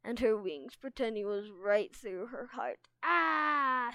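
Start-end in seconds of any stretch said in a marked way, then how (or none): 3.0-3.9 loud, high, drawn-out cry; theatrical wail; mock howl. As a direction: howl as if dying in a play. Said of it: "Sunny howled".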